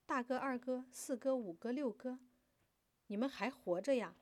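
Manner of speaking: high, loud, eager calling; excited shout. A narrator's reading. Girl greets brothers she thought dead, excited. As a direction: cry out in amazed joy. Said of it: "兴奋地喊道".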